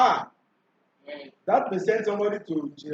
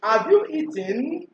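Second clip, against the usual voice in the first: slow question rising on "eaten"; question intonation; 3 semitones higher, slower than usual. Shown as a demonstration. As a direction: lift the end of "eaten" as a yes-no question. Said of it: "Questions demanding a yes or no answer".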